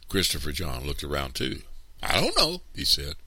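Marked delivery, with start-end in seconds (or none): 2.0-2.6 put-on very high little-boy voice; young boy. A narrator's reading. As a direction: answer as a small child. Young brother answers, worried.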